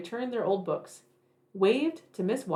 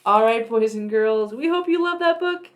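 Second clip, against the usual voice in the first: put-on cheery sign-off to kids; 2 semitones higher, 6 dB louder than usual. Storytime host thanking boys and girls, bright. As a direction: wrap up warmly for the children. Closing to children.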